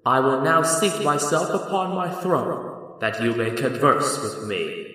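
slow, loud pronouncement from a throne; commanding, booming wizard. Acted. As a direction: intone it grandly, drawing out the words. Voice of the great Oz.

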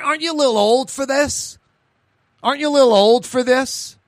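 high, loud incredulous question; incredulous challenge. Acted. Host to stunt drivers of thirty-three, incredulous scolding question. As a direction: ask it high and pointed, in disbelief.

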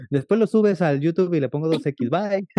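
high, breezy mock brush-off; mimicked dismissive viewers. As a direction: toss it off high, like viewers bailing out. Viewers mockingly quitting slow livestream.

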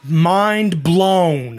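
excited. Acted. Mock amazement at discount.

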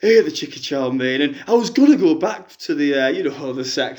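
a lightly put-on middle-aged fan on the phone; a fan phoning in with a sob story, lightly voiced; [name] caller voice. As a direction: play the hard-up caller, chatty and woeful.

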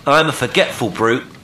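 loud, high self-reproach; exclaiming. A narrator's reading. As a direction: exclaim it at full voice. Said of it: "exclaimed Will".

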